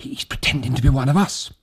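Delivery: high, hushed fearful voice; frightened, half under the breath; terrified. Acted.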